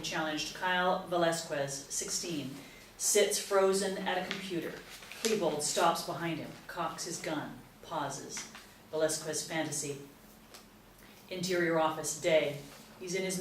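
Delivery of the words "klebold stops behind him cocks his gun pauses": ordinary talk, nothing marked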